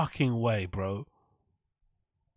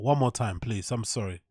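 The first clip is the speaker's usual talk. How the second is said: ordinary talk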